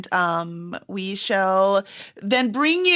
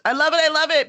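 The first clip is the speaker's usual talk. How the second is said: higher and louder, bursting with enthusiasm; real delight, repeated with rising excitement; excited gushing; 8 semitones higher, 6 dB louder, faster than usual. Real, not acted. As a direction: gush about the book you love. Host gushing over the book.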